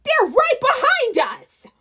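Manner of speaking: high goblin alarm; panicked goblin. Acted. Chased goblin warns the huddle, high and panicked. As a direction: squeal it in panic.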